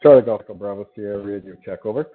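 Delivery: crisp radio check. Real, not acted. Pilot radio check call.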